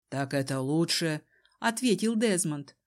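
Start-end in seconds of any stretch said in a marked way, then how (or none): 0.1-1.2 low, cool man's voice; unmoved Desmond. A narrator's reading. Desmond answers the panicking cousin, low and unmoved. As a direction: answer coolly in a deepened voice.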